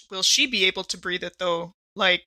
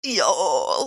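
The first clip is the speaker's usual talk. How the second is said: ordinary talk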